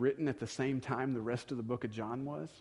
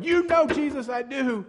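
sharply higher and louder, defensive snap; belligerent defensive; 12 semitones higher, 7 dB louder than usual. Pretend. Peter to Jesus, a belligerent defensive retort. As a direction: throw it back at him defensively. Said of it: "being belligerent with Jesus or defensive".

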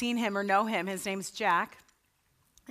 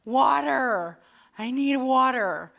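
mock scream; 3 semitones higher, 4 dB louder, slower than usual. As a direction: cry out for water, a little less each time. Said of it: "are screaming".

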